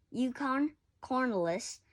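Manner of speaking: plain reading voice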